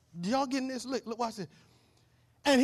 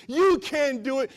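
taunting enemy voice; 5 semitones higher, 8 dB louder, slower than usual. Acted.

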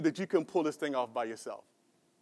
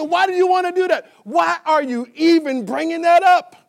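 shouted rebuke; 8 semitones higher, 8 dB louder than usual. Retold or imitated. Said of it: "he said".

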